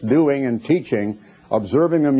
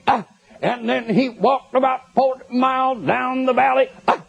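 radio preacher impression; 10 semitones higher than usual. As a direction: ham up a droning radio preacher, pitched way up. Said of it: "that's the way it sounded".

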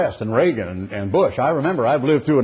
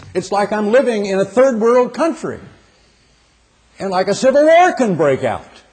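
raised, high-pitched dismayed preaching; pitched up in earnest protest; impassioned lament; 7 semitones higher than usual. Real, not acted. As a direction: lift the voice high in disbelief. Preacher laments a changed country.

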